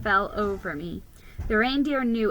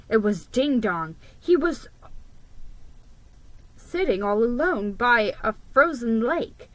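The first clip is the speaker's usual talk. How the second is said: ordinary delivery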